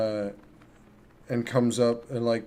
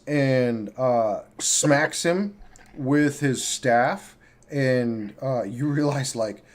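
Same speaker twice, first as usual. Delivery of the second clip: normal talking voice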